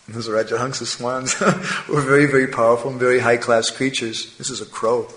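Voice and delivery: ordinary delivery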